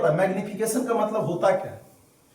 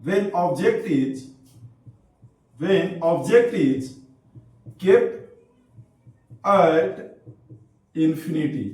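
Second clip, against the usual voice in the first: very slow, word by word with long pauses; slow dictation; 3 semitones lower, much slower than usual. Real, not acted. Teacher dictating notes to students, very slow dictation. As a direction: dictate it piece by piece for note-takers.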